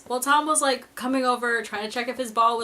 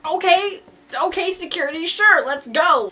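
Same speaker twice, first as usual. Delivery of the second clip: eager agreement; 6 semitones higher, 6 dB louder than usual. Retold or imitated. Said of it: "And I was like".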